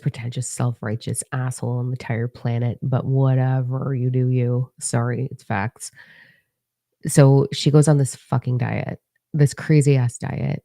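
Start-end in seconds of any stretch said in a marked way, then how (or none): none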